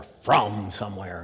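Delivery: "from" said as "frum"; southern "frum". Retold or imitated. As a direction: lean on "from", drawn out as a Southern "frum". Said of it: "she spelled it F R U M".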